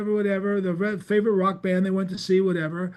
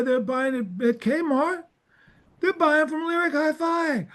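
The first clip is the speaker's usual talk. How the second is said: high-pitched, insistent salesman explaining; imitating a pushy explanation in a raised pitch; Walter Fields; 5 semitones higher than usual. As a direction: act out a cable maker's exasperated lecture, pitched up. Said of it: "He goes".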